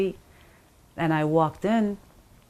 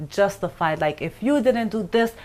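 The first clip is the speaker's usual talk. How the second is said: ordinary delivery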